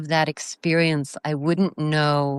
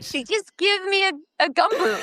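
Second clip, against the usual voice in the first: laughing; 14 semitones higher than usual. Real, not acted. Laughing over the gumboot tea.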